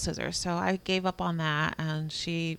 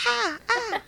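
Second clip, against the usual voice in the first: put-on high baby noises, much higher; imitating toddler; 3 dB louder than usual. Mom imitates her baby biting at a decal. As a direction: mimic a toddler touching teeth. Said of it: "she was like".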